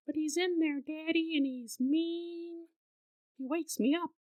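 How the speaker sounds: put-on a scared toddler pleads; small, hushed child voice; soft little girl. Daughter insists to parents.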